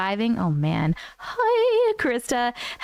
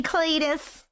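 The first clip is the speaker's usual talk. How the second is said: ordinary talk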